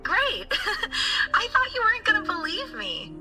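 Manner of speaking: high, laughing delight; giggly and pleased, pitched well up; delighted relief. Acted. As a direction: gush happily with a little laugh. Student delighted at being believed.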